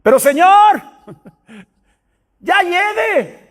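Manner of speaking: high, loud protesting cry; exclamación. Shown as a demonstration. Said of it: "exclamar, ¿qué es?". (exclamando en voz alta y aguda)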